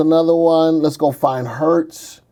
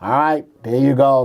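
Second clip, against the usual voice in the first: ordinary delivery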